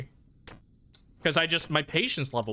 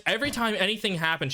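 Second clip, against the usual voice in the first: normal talking voice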